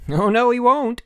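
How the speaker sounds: put-on high, loud boyish objection; insistent retort. A narrator's reading. Messenger contradicts the sleeper.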